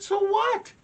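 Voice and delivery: indignant. Retold or imitated. Dad indignant at son.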